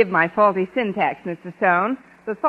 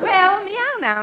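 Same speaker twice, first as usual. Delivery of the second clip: drawled high meow; western drawl; 12 semitones higher, slower than usual. Shown as a demonstration. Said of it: "stretch it out with a little western drawl".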